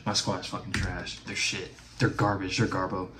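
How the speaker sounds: soft, deflated mumble; dejected muttering. Real, not acted. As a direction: let it sink, barely audible. Squad failed, dejected.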